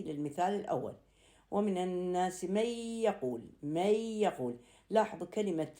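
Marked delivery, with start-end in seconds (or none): 1.5-3.4 "من يقول" merged into a long nasal y; idgham with ghunna. Shown as a demonstration. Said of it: "يحصل هنا عندنا إدغام". 3.6-4.6 "من يقول" run together as "may-yaqul", y held nasally; idgham with ghunna. Shown as a demonstration. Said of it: "يحصل هنا عندنا إدغام".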